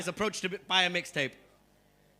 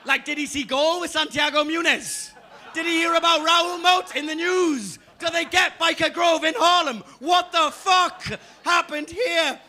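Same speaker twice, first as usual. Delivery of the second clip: higher, louder exasperated shout; incredulous rant; 7 semitones higher, 7 dB louder than usual. Real, not acted. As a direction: rant in disbelief at the crowd. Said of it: "incredulous rant".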